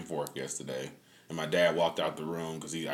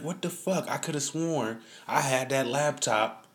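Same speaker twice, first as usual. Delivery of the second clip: retold disbelief; 7 semitones higher than usual. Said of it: "I'm like".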